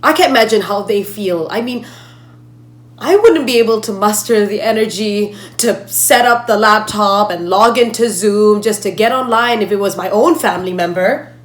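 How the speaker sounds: loud, impassioned dismay; distressed outburst. Acted. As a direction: burst out, upset on the family's behalf. Outburst over the grieving family.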